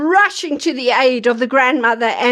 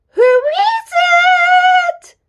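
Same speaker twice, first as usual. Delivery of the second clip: wolf as granny; 3 dB louder than usual. Acted.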